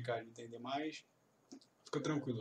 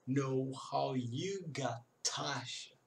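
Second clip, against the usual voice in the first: whispered slow "know how you got Tasha"; much slower than usual. Shown as a demonstration. Teacher whispers the line slowly for students. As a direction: say it without voice, slowly. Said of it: "whispered demo".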